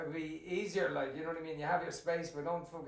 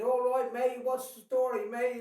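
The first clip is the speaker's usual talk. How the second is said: higher, louder put-on English accent; mock English accent; 5 semitones higher, 5 dB louder, slower than usual. Irish dad mocking emigrants back from England, English accent. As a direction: send up an English accent, pitched up. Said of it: "they're fucking having an English accent".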